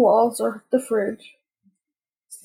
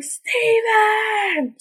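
high, mock-frantic call for help; playful hollering; 10 semitones higher than usual. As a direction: call out across the church in fun. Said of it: "I go around the church hollering".

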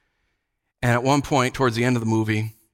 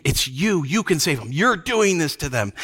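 usual speaking voice

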